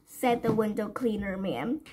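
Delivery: plain reading voice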